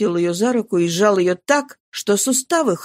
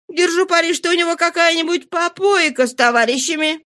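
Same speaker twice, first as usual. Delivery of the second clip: higher-pitched woman's grumble to herself; higher, indignant character voice; Nana, indignant; 6 semitones higher than usual. Acted. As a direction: play a peeved woman venting about a man.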